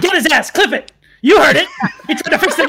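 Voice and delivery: excited triumphant accusing call; gleeful shouting. Real, not acted. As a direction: crow it out gleefully.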